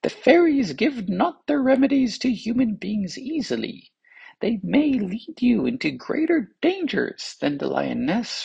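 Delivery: put-on high, eager young physician voice. A narrator's reading. Young physician tells the king he is bewitched, high voice.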